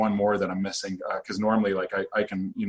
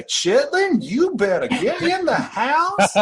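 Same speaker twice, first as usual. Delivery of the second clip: much higher, slow, hammed-up Southern drawl with a laugh; mock Southern drawl; 5 dB louder, much slower than usual. Imagining Reagan calling her kid inside in a mock Southern drawl. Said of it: "I'd love to hear Reagan say".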